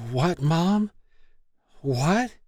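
stuttering. Acted. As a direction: stammer it out dazed. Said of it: "Stacy stuttered".

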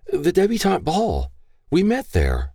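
put-on high, nervous girl's voice; young woman's voice. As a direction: answer quickly, voice high and young. Daughter answering her father.

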